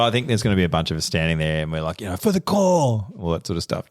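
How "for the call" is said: high, hammed-up rallying cry; mock yell. Guys standing there in a training montage, mock yelling. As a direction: pitch it up like a group battle cry. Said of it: "just yelling that sort of stuff out".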